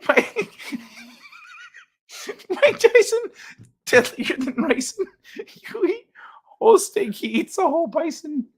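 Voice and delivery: high, squeaky words through laughter; laughing helplessly. Real, not acted. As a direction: try to read while cracking up. Cracks up reading a chat rhyme.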